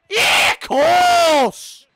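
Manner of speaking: very high, very loud, long-drawn shout; exasperated yell. Real, not acted. Gamer reacting to Bruno's arrival, long exasperated yell. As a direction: bellow it high and stretched out. Yelling at the game.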